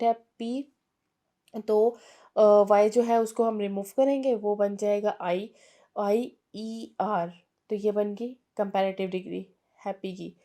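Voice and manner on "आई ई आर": letters spelled out one by one, slowly; slow spelling. Shown as a demonstration. (अक्षर अलग-अलग धीरे बोलना)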